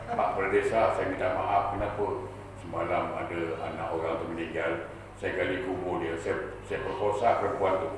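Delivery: low, subdued apology; meek confession. Acted. Villager apologising to the village head, meek and low.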